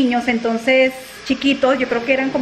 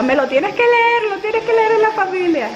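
teasing friend; 8 semitones higher than usual. Retold or imitated. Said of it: "soltó la risa".

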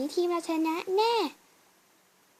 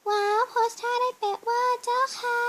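excited wow; 7 semitones higher than usual. Acted. Cheering a friend's modelling pose.

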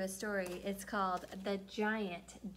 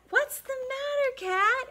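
bear voice; 7 dB louder than usual. Acted. Bear asks.

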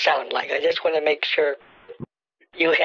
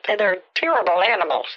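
ordinary delivery

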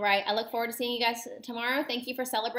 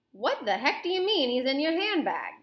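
indignant, mildly raised storybook voice; an understated shout of disbelief, slightly higher; 4 semitones higher, 4 dB louder than usual. A narrator's reading. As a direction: read it as a blustering, half-shouted question. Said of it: "Mister Jenkins shouted".

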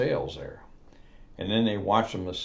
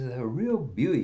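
eager salesman; 7 semitones higher than usual. Acted. Salesman pitching a boat.

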